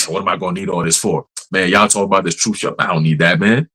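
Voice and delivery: low, dismissive scoffer; mock scoffer voice. Preacher mocking a careless scoffer, low and dismissive. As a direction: brush off the warning lazily.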